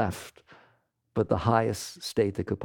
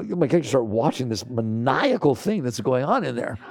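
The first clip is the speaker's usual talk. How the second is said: ordinary talk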